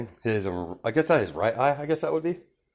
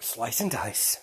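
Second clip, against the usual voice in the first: whispered gleeful threat; menacing whisper; 2 semitones higher, 5 dB quieter, slower than usual. Acted. Axe-wielder chopping up his foe, sinister whisper. Attacker taunts while chopping.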